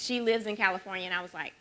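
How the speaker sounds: ordinary talk, nothing marked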